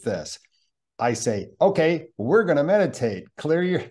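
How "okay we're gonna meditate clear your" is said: brisk, louder and higher, addressing a room; rally the room to get ready; rousing group instructions. Acted. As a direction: workshop leader calling out instructions.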